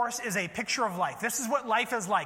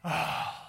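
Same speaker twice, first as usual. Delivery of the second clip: exasperated eye-roll sigh; heavy put-upon sigh; teenager's sigh; 4 semitones lower than usual. Acted. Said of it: "Like a teenager, you know, rolling their eyes".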